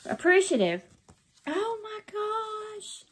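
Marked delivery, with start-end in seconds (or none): 1.5-3.0 soft, high, drawn-out amazement; awestruck. Real, not acted. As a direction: breathe it out in wonder. Marvels at the gift.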